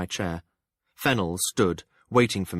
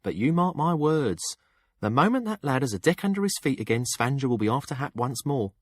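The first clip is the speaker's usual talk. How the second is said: higher-pitched sour warning; mildly sour prediction in a raised pitch; 7 semitones higher than usual. A narrator's reading. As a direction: warn sourly, drawing out the first words. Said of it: "Jinnah observed sourly".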